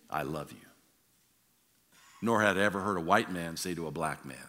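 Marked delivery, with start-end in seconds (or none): 0.1-0.7 a quiet, gentle I love you; soft tender quote. Retold or imitated. Preacher quoting a man's love for another man, softly. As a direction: let it land softly, sincere.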